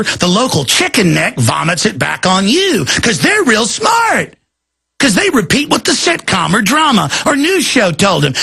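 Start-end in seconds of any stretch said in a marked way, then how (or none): 2.8-5.7 sarcastic jab. Real, not acted.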